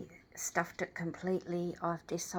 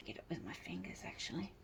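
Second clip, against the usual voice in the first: whispering; 2 semitones lower, 13 dB quieter, faster than usual. Real, not acted. Muttering to herself while working.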